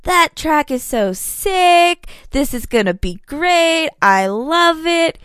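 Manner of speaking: high, drawn-out gushing, imitated; thrilled rider imitation. Rider back from first practice, imitated excitement. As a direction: stretch the words out with mock-thrilled enthusiasm.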